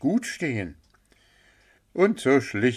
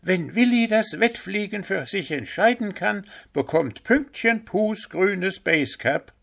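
bunnies announcing; 11 semitones higher than usual. A narrator's reading. The bunny kids announce the bet, high proud chorus. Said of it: "verkündeten nun".

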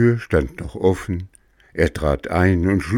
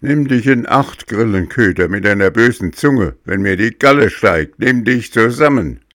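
put-on loud, threatening puppet boy; 5 semitones higher, 5 dB louder than usual. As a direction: threaten the cricket.

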